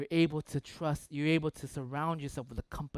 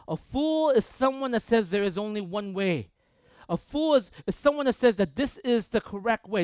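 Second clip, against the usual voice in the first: loud, high, fervent preaching; 5 semitones higher, 7 dB louder than usual. Acted. Pastor preaching loudly to the congregation about fools. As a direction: preach it out to the congregation at full force.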